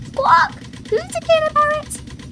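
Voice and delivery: very high, loud squawking parrot; Polly Parrot. A narrator's reading. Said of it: "Polly copied".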